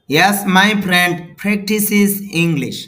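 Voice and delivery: slow, loud, high model read, stress on practices; model sentence. Shown as a demonstration. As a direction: read it out loud and clear for learners. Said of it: "তাহলে খেয়াল করেন".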